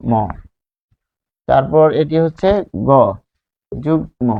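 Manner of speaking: normal talking voice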